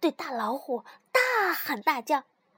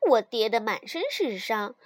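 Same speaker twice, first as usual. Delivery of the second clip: usual speaking voice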